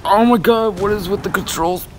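high, loud cry of frustration; exasperated outburst. Real, not acted. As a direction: burst out against uncooperative controls. Fighting the game controls.

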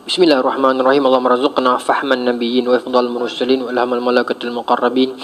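low, softening Arabic prayer; closing prayer recitation. Real, not acted. Preacher closing the talk with a dua, low prayer recitation.

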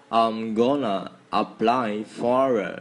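very slow, each word spaced; linking sounds said slowly; slow repeat. Shown as a demonstration. Teacher slowly repeating to show linked sounds. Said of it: "Nhắc lại nhá".

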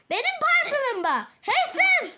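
indignant laughing shout; laughing outrage. Acted. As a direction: laugh while calling them out. Finding her perfume packed away.

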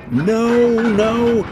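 high, loud frightened wail. A narrator's reading. Empty trucks pleading as loaded ones rush, high wail. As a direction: cry out in panic. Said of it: "wailed the empty trucks".

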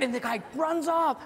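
normal talking voice

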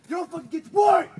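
bellowed drill-sergeant order; full-throated furious command; angry shout. Acted. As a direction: yell it down the trail at the slackers. Barking orders at crew.

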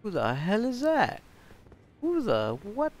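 high, loud startled repeat; real surprise, pitch jumping up. Real, not acted. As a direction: react to a stranger appearing. Surprised by new NPC.

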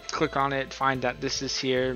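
ordinary talk, nothing marked

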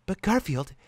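put-on high, pleading girlish voice; girlish whine. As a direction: plead like a fretful girl. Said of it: "cried out Tally with girlish crying".

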